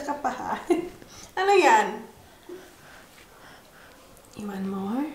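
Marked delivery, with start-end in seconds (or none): 4.3-5.1 quiet gentle question; soft coaxing. Real, not acted. Mother quietly offering her baby more, soft.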